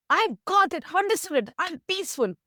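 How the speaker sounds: high-pitched, delighted announcement; very happy. Acted. Man returns to his teacher thinking he understands, very happy. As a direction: burst out with happy certainty. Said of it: "he says very happily".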